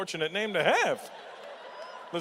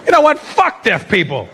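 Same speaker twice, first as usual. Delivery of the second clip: fed-up shout; 5 semitones higher, 15 dB louder than usual. Retold or imitated. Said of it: "I was like".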